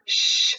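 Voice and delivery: long hushing "shh"; shushing. Shown as a demonstration. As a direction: shush someone in a library. Acts out shushing a student.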